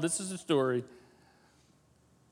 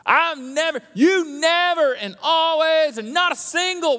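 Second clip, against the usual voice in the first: mock drama words; 9 semitones higher, 4 dB louder than usual. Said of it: "those drama words".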